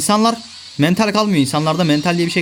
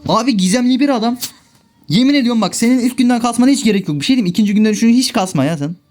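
furious rant; 7 semitones higher than usual. Real, not acted. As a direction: blow up at the game, half shouting.

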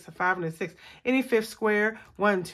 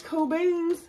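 silly voice; 8 semitones higher than usual. Pretend. Catchphrase in put-on voice.

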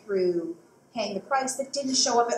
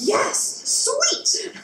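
excited; 5 dB louder than usual. Real, not acted. Gleeful reply to blowing stuff up.

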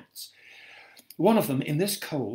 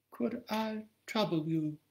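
high and soft, timid asking; meek frail voice; 8 semitones higher, 6 dB quieter than usual. Acted. Blinded woman softly asking a stranger for help, high and timid. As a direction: ask as if barely daring.

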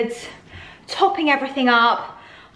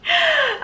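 laugh; 3 dB louder than usual. Real, not acted. Laughs over cup of tea.

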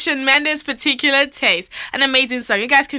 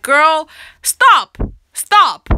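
exasperated stop; 4 semitones higher, 6 dB louder, much slower than usual. Real, not acted. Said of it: "telling sister to stop".

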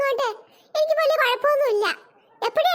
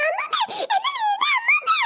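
much higher desperate crying plea; wailing child; 11 semitones higher than usual. Acted. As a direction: wail to be let go. Child wails to grandma. (വിടാൻ കരഞ്ഞു കെഞ്ചുന്ന കുട്ടി)

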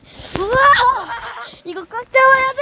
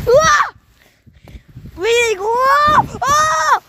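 high frightened screams on a ride; screaming; 5 semitones higher, slower than usual. Real, not acted. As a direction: scream as it spins out of control. Screams on spinning swing. (겁에 질린 비명)

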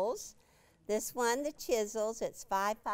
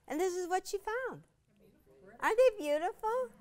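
high, fast, thrilled gush; delighted; 10 semitones higher, 5 dB louder, faster than usual. Real, not acted. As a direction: gush over the fabrics. Delighted showing fabrics.

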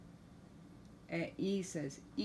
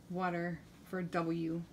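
ordinary delivery, nothing marked

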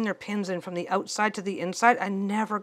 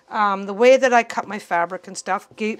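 ordinary talk, nothing marked

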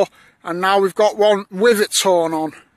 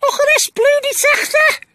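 squeaky falsetto, much higher and louder; silly high voice; 3 dB louder than usual. Pretend. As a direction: greet like a cartoon unicorn. Squeaky unicorn voices exchanged.

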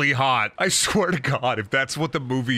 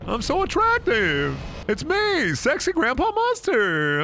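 much higher, slow, preening vain voice; hammed-up vain old man; sexy Grandpa Munster; 11 semitones higher, slower than usual. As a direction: show off how attractive he is. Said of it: "he's like".

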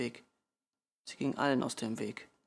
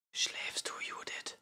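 very soft bedtime whisper; 8 dB quieter, slower than usual. A narrator's reading. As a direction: whisper it so nobody wakes. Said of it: "flüsterte ich". (leise geflüsterte Frage)

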